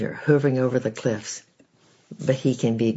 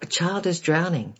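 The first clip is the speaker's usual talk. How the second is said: alarmed cry, much higher; calling out; 7 semitones higher than usual. A narrator's reading. Bystanders on the bridge call out the alarm, pitched high. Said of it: "I heard people calling out".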